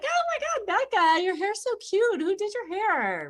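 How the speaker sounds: put-on squealing high girlish gush. Straight girlfriend fawning over a crush, high and squealing. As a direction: gush over her hair like a giddy friend. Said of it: "it's like".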